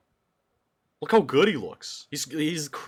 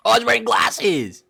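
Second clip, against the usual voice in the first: excited; 11 semitones higher, 9 dB louder than usual. Real, not acted. Excited reaction to fan art.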